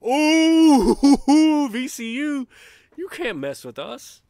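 lightly voiced, laughing chat quote, loud ooh; reading a chat comment. Host reading Beach Bear's chat comment, amused. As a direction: read the viewer's comment with a grin. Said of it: "Beach Bear says".

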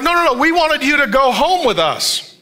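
insistent parents. Acted. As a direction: act out parents correcting their son, voice lifted. Parents protest to their son.

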